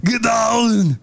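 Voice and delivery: imitated action-hero yell, high and drawn out; mock strongman shout; Arnold Schwarzenegger. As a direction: belt it like an action star. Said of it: "You can do the yell".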